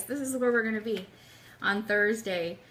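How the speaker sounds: normal talking voice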